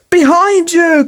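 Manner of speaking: high urgent warning cry; cried out warning. A narrator's reading. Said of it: "cried Mister Mole".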